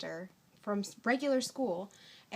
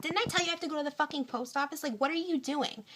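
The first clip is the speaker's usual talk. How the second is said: higher, fast, mock freak-out; retold rant in a fast, raised voice; 6 semitones higher, faster than usual. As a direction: rattle it off as the panicked girlfriend. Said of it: "she starts freaking out".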